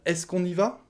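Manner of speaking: "Est-ce qu'on y va?" pitched high as a question; full question form. Shown as a demonstration. Teacher showing what isn't said orally, questioning. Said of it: "On ne dit pas à l'oral".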